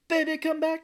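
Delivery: hammed-up lovelorn cry; mock pleading call. Riffing on calling after her.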